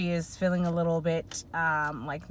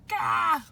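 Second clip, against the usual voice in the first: flustered gah; 12 semitones higher, 5 dB louder than usual. Retold or imitated. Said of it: "It's very, like".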